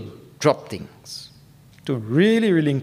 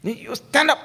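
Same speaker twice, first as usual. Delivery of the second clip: urgent command; 10 semitones higher than usual. Shown as a demonstration. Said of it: "It shows urgency".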